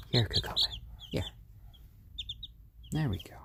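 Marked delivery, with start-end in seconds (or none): 0.1-1.2 1 coaxing whisper. Real, not acted. Owner coaxing her chick closer, whispered. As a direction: whisper her over gently.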